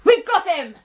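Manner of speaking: loud, high shout of capture; triumphant cry. Acted. Robin shouting that they've caught the wolf. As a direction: call it out in triumph. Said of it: "Robin cried".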